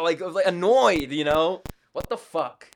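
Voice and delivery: ordinary delivery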